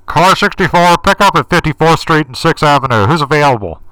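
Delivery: loud, high crackly taxi radio call; distorted voice. Retold or imitated. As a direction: bark it like a dispatcher over a bad radio. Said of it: "you hear in a distorted voice".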